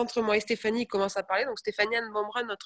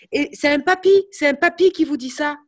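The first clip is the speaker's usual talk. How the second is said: amazed; 5 semitones higher, 9 dB louder than usual. Real, not acted. Amazed that an old man said it.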